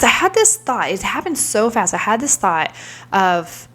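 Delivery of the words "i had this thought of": ordinary delivery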